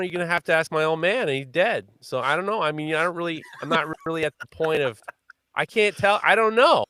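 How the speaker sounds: building from high to shouting in disbelief; exasperated rant. Retold or imitated. Exasperated reply retold to plumber.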